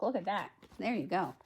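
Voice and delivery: plain reading voice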